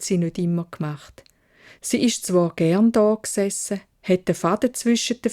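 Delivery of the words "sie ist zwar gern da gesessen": ordinary delivery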